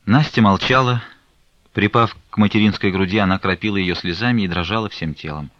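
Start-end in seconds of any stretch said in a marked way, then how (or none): none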